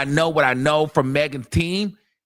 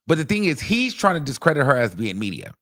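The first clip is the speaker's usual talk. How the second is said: ordinary talk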